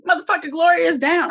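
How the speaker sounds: shouted out, high and loud; excited shout. Real, not acted. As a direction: blurt the news out loud. Excited announcement of news.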